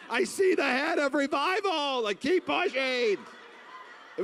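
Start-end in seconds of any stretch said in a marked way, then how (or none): 0.1-3.2 high loud coaching yell, slowing at the end; hammed-up shouted birthing coach; midwife shout. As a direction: urge them on like a delivery coach.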